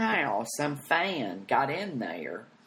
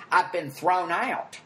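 higher, slow, drawn-out outrage; indignant announcement; 6 semitones higher, 4 dB louder, slower than usual. Retold or imitated. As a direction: announce it grandly to the whole room. Announces eviction to the crowd.

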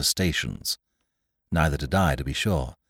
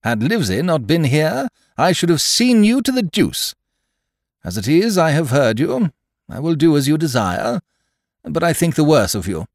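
high, loud, blustering voice; irate squire; 12 semitones higher, 9 dB louder than usual. Acted. Squire rebuking the captain, high and indignant. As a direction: scold indignantly at a high pitch.

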